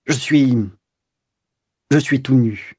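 halting, embarrassed stammer with long gaps. A narrator's reading. Caught lover owning up to the commissioner that he is naked, stammering. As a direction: stumble and break off, mildly flustered. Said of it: "L'autre balbutia".

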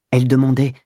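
plain reading voice

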